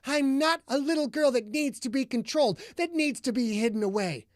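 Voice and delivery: high, loud and fast rant building; furious tirade. A narrator's reading. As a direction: hurl every line at him in rage.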